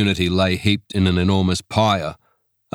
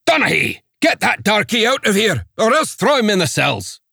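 put-on thick Scots accent, higher and barked; a Scots magistrate snapping orders at the guard; barking Scots magistrate; 13 semitones higher than usual.

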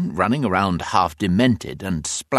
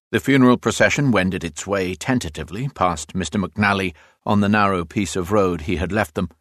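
plain reading voice

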